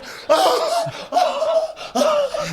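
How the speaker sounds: high-pitched wheezing cackle; imitated squealing laughter; high hysterical laugh. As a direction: crack up uncontrollably, high. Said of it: "he's like".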